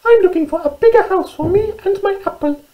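very high, squeaky mouse voice; little mouse. Acted. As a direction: squeak it out like a tiny storybook mouse. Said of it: "she said".

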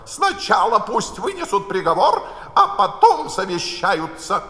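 the Queen dictating loudly to the court; commanding Queen. A narrator's reading. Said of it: "крикнула королева".

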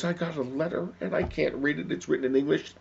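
high-pitched, upset mother; acted tearful distress. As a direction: complain high, as if through tears. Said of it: "she's crying".

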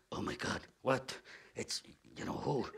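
soft, breathless panicked hush; shocked whisper. Acted. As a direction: gasp it under your breath. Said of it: "shocked, realizing it's a theater critic".

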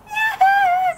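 high-pitched pretend wail; pretend scream. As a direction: shriek as a hurt doll calling for help. Hurt doll screams from afar.

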